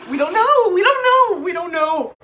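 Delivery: high and loud, breaking; distraught crying. Real, not acted. Not knowing what happened to the baby, crying. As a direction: cry it out twice.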